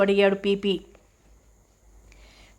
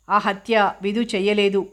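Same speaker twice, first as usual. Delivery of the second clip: ordinary delivery, nothing marked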